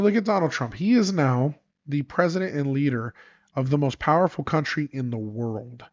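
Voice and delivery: normal talking voice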